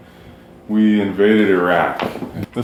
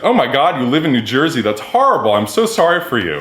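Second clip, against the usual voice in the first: higher, louder hammed-up dismay; mock horror; 6 semitones higher, 4 dB louder than usual. [name] mocking New Jersey to the laughing table, hammed-up horror. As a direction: overdo the pity for a joke. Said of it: "he goes".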